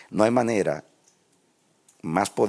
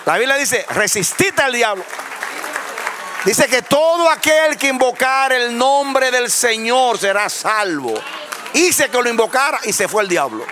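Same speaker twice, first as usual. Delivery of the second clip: high, loud preacher's cry; fiery preaching; 12 semitones higher, 6 dB louder than usual. Acted. Pastor rouses the congregation against the devil, loud high preaching. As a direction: preach it to the back row.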